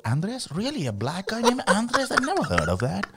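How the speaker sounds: high, soft surprised questioning; intrigued girl. Acted. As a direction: react like a girl hearing an unexpected name. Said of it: "And then they're".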